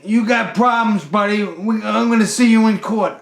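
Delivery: high, loud indignant outburst; retold heated shouting; angry threat. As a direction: imitate a furious customer threatening you. Angry customer threatening to sue.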